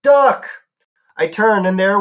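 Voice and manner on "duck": high, loud shout; sharp yell, pitched way up; yelled warning. A narrator's reading. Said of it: "Grover yelled".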